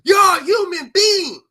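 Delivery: slow, high, loud cry of outrage; anguished shout. Real, not acted. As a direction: yell it, voice breaking with feeling. Emotional climax of the rant.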